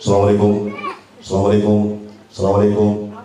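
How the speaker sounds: low, monotone debt-collector greeting; imitating an ominous caller at the door; slightly unsettling salam. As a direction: repeat it evenly on one low note. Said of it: "kalau salamnya agak-agak meresahkan sedikit".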